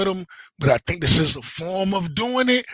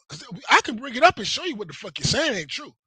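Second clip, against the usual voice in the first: ordinary delivery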